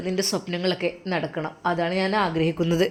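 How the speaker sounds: hushed, heartfelt reassurance; very soft, caring murmur; tender. Acted. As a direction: drop softly into a brother's tender promise. Brother tenderly comforting his sister.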